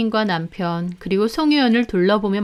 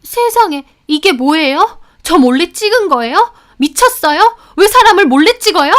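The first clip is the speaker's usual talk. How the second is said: much higher, louder shrill fuss; hysterical outburst; 9 semitones higher, 8 dB louder than usual. A narrator's reading. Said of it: "호들갑을 떨며 난리를 치는 겁니다".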